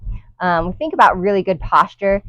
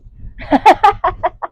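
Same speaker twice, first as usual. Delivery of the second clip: breathless laugh; 12 semitones higher, 5 dB louder than usual. Real, not acted. Laughing at being winded.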